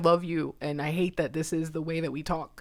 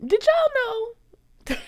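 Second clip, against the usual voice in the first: surprised. Retold or imitated. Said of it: "And I'm like".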